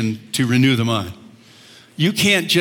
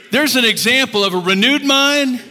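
normal talking voice

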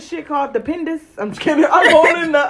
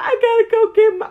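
high mock wail; high, whiny imitation of crying; 9 semitones higher than usual. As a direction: imitate a tearful friend. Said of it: "She fucking cried".

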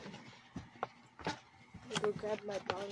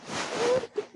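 laugh-crying; 14 semitones higher, much slower than usual. Real, not acted.

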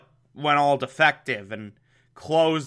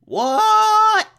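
high, loud, shocked squawk; shocked what; 7 dB louder than usual. Retold or imitated. Dick hearing Alfred was taken, shocked high squawk. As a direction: blurt it out in total disbelief. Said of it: "Dick's like".

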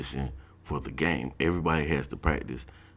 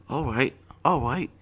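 much higher, lisping gold-teeth voice; Baltimore guy impression; 9 semitones higher than usual. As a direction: mimic the funny gold-teeth talk. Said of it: "he said it just like that".

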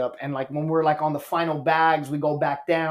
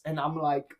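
normal talking voice

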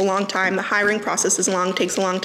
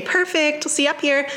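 chirpy, higher barista sign-off; drive-thru speaker; 6 semitones higher than usual. Retold or imitated. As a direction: sing it out like a barista. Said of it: "I would do that over and over".

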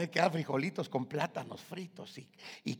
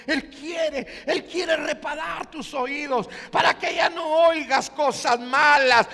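loud, high shouted altar call; preaching at full force; 11 semitones higher, 14 dB louder than usual. Acted. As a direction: call them forward with fervour.

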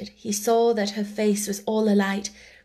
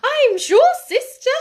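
much higher, flustered little voice; flustered sister; 13 semitones higher, 8 dB louder than usual. Acted. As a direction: dither nervously to your sister. Flustered younger sister answers.

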